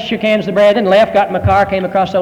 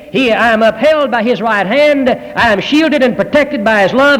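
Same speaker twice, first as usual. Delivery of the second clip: preaching; 7 semitones higher than usual. Acted. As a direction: build each line of assurance. Preaching climax.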